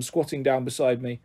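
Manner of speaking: plain reading voice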